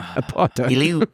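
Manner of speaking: put-on stretched slow Apartheid in a South African accent. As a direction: drag out Apartheid slowly, South African style. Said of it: "We're learning".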